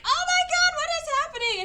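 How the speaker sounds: mock panicked shriek. Heroine watching her date shot by an arrow, a high panicked shriek. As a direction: squeal it like a panicked woman. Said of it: "and she's like".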